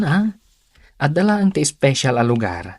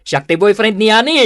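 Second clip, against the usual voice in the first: high, loud proclamation to the whole street; proud public announcement; 11 semitones higher, 6 dB louder than usual. Acted. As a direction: announce it like a politician on the campaign trail. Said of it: "Kasla ka la politiko nga agkamkampanya".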